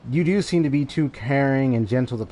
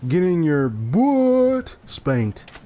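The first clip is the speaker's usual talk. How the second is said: drawn-out taunt; 4 semitones higher, much slower than usual. Acted. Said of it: "changing the words just to be funny".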